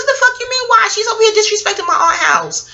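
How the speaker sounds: high, indignant outburst from the cousin; cousin's indignant retort. Retold or imitated. Cousin defending her aunt's house, high and indignant. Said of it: "She said".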